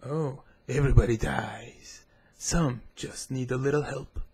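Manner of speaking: slow, drawn-out champion voice; game champion impression. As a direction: intone it like a game character's catchphrase. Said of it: "I can do that better than Warwick".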